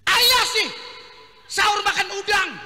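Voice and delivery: high, loud nagging scold; scolding wife. Acted. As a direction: scold him for the shrimp. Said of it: "diomelin lakinya".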